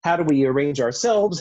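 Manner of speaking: normal talking voice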